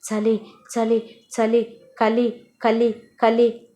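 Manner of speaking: slow, separated chattering chant; acted shivers, each word drawn out; shivering with cold. A man trembling with cold and hardship, shivering chant. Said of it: "చలితో వణికిపోతున్నాను".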